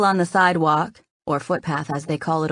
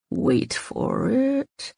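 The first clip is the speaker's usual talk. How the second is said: through clenched teeth; 7 semitones higher, much slower than usual. Acted. Man restrains his eager girlfriend, through clenched teeth. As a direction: hold it back, speak through your teeth. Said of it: "through clenched teeth".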